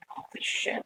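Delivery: breathless whisper, no voice at all; whispered holy shit. Retold or imitated. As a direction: whisper it so the ghost can't hear you. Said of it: "and I'm like".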